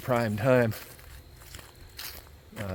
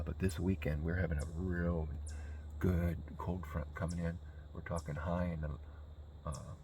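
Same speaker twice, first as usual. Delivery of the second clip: quiet; 3 semitones lower, 10 dB quieter than usual. Real, not acted. Hunter talking quietly in stand.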